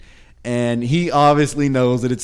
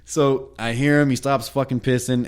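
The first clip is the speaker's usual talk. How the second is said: ordinary delivery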